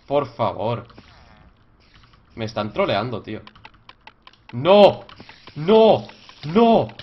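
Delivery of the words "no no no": loud repeated despairing no; loud cries of no. Real, not acted. Gamer shouting at another failure. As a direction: yell no three times. Failing again.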